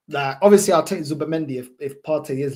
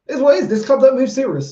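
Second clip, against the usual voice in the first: raised, exasperated outburst; 9 semitones higher, 7 dB louder than usual. Real, not acted. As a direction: vent it loud and fed up. Frustrated fan venting about club.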